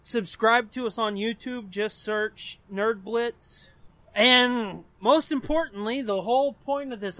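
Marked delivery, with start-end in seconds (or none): none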